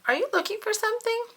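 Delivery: high, fast Big Bird voice; friendly big bird voice. A narrator's reading. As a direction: chirp it up high and quick.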